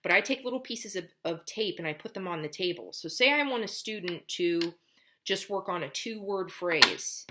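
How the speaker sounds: ordinary talk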